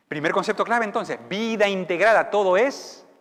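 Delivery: loud, rising sermon; full-force preaching. Acted. Preacher to church, thundering over the king's might. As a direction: build it up to a preaching roar.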